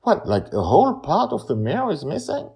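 high voiced boy, worried; boy's high voice, puzzled; child's voice. A narrator's reading. Charlie voiced, high pitched.

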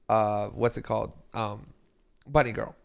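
ordinary delivery

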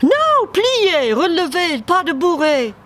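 high, clipped ballet commands; demanding dance teacher. Acted. Harry drilling Dave on the ice, bossy and high. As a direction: call the steps out like a fussy instructor.

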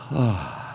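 soft, breathy exhale of relief; sigh of relief. Acted. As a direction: let the worry go in one sigh. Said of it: "The relief?".

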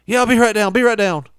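loud, high shout down the stairs; acted husband hollering a hurried reply; calling back.

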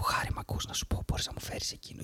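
very soft, voiceless whisper; whisper in the ear. Acted. Host demonstrating a classmate's accidental ASMR, whispering. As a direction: whisper a small favour close to someone's ear. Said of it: "να έρθει να σου πει στο αυτί κάτι".

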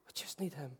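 soft whisper of need Him; hushed whisper to the congregation; whispered repeat. Shown as a demonstration. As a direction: breathe it almost silently.

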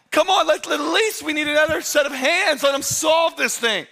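high-pitched frantic yell; acted panicked yelling. Disciples to each other as the boat floods, frantic yelling. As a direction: yell like a crew in a sinking boat. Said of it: "they're yelling at each other".

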